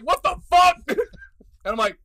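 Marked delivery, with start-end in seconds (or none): 0.0-0.8 loud, high startled exclamation; startled outburst. Retold or imitated. A man on the phone hearing a stranger, a startled loud outburst. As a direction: blurt it out in shock. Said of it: "the dude on the phone's like".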